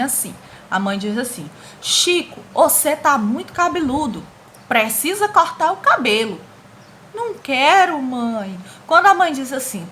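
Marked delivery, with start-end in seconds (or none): 1.8-6.4 higher, louder bossy mom ordering; mother's scolding voice. Acted. Teacher reading a comic, voicing the bossy mother. 7.1-8.6 put-on whiny kid refusal. Teacher voicing boy refusing haircut.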